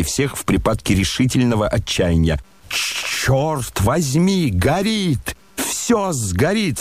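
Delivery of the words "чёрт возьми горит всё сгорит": higher, slow, panicked cry; alarmed outcry. A narrator's reading. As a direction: shout in dismay at the fire. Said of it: "вскричал он".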